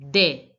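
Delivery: short, loud de rhyming with "day"; accented e sound. Shown as a demonstration. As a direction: say de like an accented French e. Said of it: "it would have been pronounced as".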